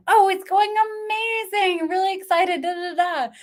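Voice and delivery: high, gushing fake cheer; fake enthusiasm. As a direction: gush brightly through a lie. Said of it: "lie through your teeth".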